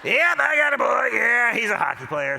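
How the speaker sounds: dad booming with pride to another parent; proud dad. Retold or imitated. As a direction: crow it out like a bursting-proud father. Proud dad bragging to stranger.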